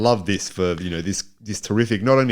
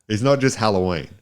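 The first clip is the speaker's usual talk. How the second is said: ordinary talk, nothing marked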